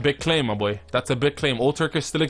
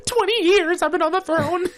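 mocking the queen's American accent, raised pitch; American-sounding queen. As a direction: imitate the movie queen in a high, plain American voice. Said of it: "You don't sound like a medieval queen".

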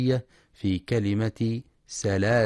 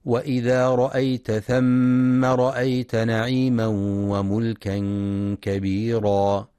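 Quran recitation; 4 dB louder than usual. Acted. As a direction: chant the verse with measured tajweed. Recites verse in tartil.

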